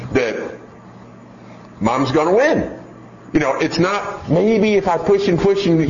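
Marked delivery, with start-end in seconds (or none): 4.3-5.9 lightly voiced child, pitched up and pushy; a hint of a wheedling child; whiny child pushing. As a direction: play the kid testing mom. Child testing mom's limits.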